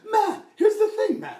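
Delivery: very high, loud, strained protest; hammed-up impression, pushed nearly to a shout; Tom Henry. Tom asked about a jerk musician, high evasive outburst. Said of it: "And he's like".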